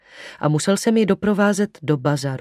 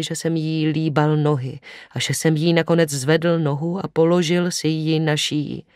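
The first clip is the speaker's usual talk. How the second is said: plain reading voice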